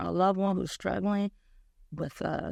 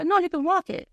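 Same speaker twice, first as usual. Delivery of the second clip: higher and louder, insistent; high, loud insisting; 11 semitones higher, 5 dB louder than usual. Retold or imitated. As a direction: push her on brightly. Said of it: "friend insisting".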